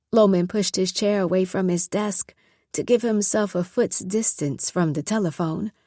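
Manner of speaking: usual speaking voice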